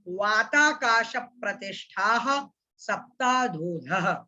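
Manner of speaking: each word separate, slowing to a crawl at the end; broken-up recitation. Shown as a demonstration. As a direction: break the sutra into pieces, one word at a time. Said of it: "कैसे उच्चारण करना है".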